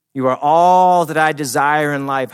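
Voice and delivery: loud, slow, high declaration; crying out to God. Acted. David declaring God his only desire, crying out in praise. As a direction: proclaim it with full-voiced devotion. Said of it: "I cried out to you".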